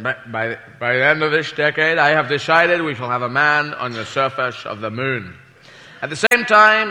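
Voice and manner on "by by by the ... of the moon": low, slurred presidential impression; put-on statesman, s's slipping to sh; President Kennedy. President announces the moon mission, low slurred mock oratory. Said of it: "President Kennedy said".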